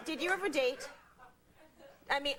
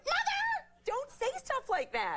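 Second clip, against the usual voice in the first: high, slow horrified daughter; a shocked high "Mother" scolding; shocked protest; slower than usual. Retold or imitated. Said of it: "I'm like".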